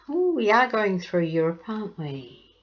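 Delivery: ordinary talk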